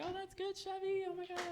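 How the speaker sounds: tiny, very high, soft squeak; high forced approval. Acted. Ty answers Chevy's bomb news, high soft forced approval. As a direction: squeeze it out high and quiet.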